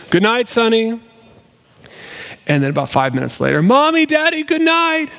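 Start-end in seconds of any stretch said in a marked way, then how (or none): 0.1-1.0 higher-pitched parent's call down the hallway; mock holler back to a child; re-enacted bedtime call. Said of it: "through hallways, yelling". 3.6-5.1 put-on high singsong holler of a kid at bedtime; wailing child. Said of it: "we'll hear wailing across the span".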